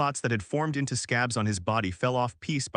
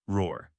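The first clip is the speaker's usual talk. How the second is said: deep low growling roar; 5 semitones lower than usual. Acted. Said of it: "let out a hoarse howl".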